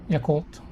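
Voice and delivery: Yakult quick and even, short ya; said as spelled. Shown as a demonstration. Explaining Tagalog reading, said as it is spelled. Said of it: "say it as it is spelled".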